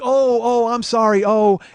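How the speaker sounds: high, loud imitation of a woman; flustered apologetic woman. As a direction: call out a hurried apology. Acted woman hurrying to apologize.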